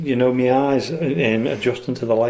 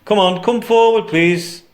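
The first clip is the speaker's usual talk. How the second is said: raised, coaxing call; 7 semitones higher, 4 dB louder, slower than usual. Real, not acted. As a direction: call loudly into the dark room. Calling out to spirits.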